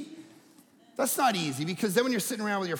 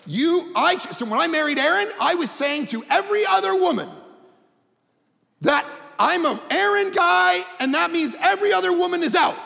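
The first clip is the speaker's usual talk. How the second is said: emphatic preaching; 10 semitones higher, 5 dB louder than usual. Real, not acted. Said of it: "emphatic preaching".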